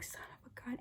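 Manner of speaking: very soft whisper under the breath; whispered mild curse. Retold or imitated. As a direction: whisper it to yourself. Retold muttered aside, whispered.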